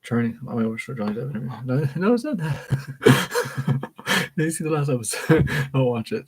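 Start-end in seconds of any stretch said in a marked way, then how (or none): 1.6-6.3 real laughter breaking through the words; laughing talk. Real, not acted. Friend warns listeners off an episode, laughing. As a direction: talk through a giggle fit, pitch jumping up.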